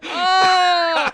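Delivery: loud, high Oh of delight; excited shout. Real, not acted. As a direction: yell it in delight.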